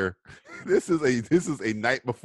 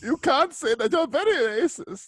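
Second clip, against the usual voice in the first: a hammed-up German accent, higher and slowed; mock German accent; 12 semitones higher than usual. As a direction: playfully scold in a silly mock German accent. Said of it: "why he's German all of a sudden".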